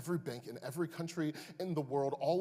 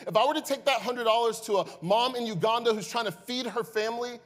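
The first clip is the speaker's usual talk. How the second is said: louder, higher impassioned preaching; preaching at full force; 10 semitones higher, 10 dB louder than usual. Acted. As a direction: plead with the church from the pulpit.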